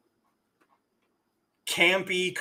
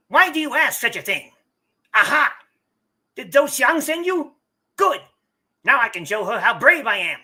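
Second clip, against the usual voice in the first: high, loud whiny weasel voice; 5 semitones higher, 6 dB louder than usual. Acted. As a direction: play a sniveling weasel of a rich brat. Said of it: "he's getting weasel voice".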